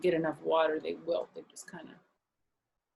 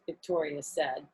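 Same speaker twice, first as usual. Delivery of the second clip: ordinary delivery, nothing marked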